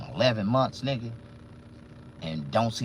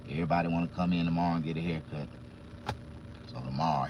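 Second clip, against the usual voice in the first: ordinary talk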